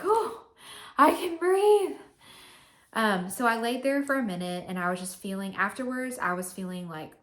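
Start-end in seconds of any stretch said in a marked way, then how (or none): 0.0-2.0 high, loud gasp of relief; relieved cry. Retold or imitated. Finally able to breathe after the procedure, high loud relief. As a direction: burst out with relief as the pain ends. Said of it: "So I finally was like".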